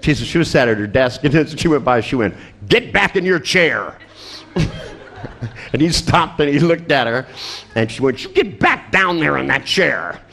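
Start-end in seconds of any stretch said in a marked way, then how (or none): 2.7-3.9 higher-pitched, sharp acted order; nurse's command. Unfazed nurse to patient running past, high commanding call. 8.2-10.2 higher-pitched, lightly acted order; nurse's command. Nurse to patient who stopped and looked, insistent high order.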